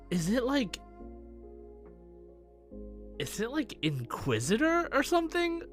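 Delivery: normal talking voice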